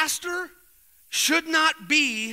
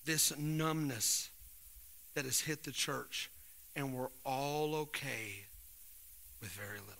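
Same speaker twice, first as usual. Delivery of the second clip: much lower and softer, intimate; soft confiding talk; 9 semitones lower, 13 dB quieter than usual. Real, not acted. Pastor confiding with ashamed men, soft and low. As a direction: lean in and speak quietly.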